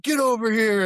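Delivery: mock order called across a field; retold call-out. Instructor summoning him over at night, retold call-out.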